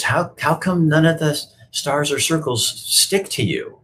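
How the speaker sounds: little puppet voice. Retold or imitated. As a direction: ask it like a wide-eyed little puppet.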